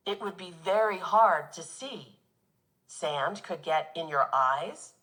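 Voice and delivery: ordinary talk, nothing marked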